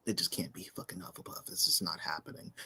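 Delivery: unvoiced whisper aside. Real, not acted. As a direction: breathe it out under the breath. Muttered aside.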